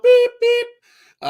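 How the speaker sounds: high, loud bleeped beeps; spoken morse code. Pretend. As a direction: imitate a censor bleep with your voice. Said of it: "sound like Morse code".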